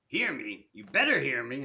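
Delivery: loud, forceful warning; booming voice. A narrator's reading. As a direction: make him hear you, loud and commanding. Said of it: "in a voice and volume impossible not to".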